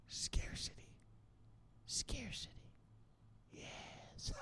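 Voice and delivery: breathy stage whisper of one word; hushed, secretive whisper. Acted. Whispered for effect.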